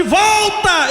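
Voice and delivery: high, urgent cry; shouted command. Retold or imitated. Mother commanding her weeping son to return, shouting. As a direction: call the son back with full force.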